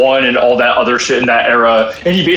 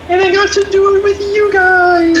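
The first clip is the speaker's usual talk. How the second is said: excited. Real, not acted. Cheering about cooking with friends.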